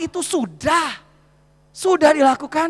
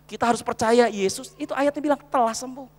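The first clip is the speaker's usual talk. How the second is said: ordinary talk, nothing marked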